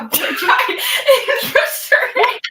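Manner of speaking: laughing out the punchline. Real, not acted. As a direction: laugh through the end of the story. Telling a funny story.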